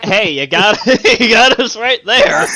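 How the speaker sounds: laughing. Real, not acted. Laughing at friend's joke.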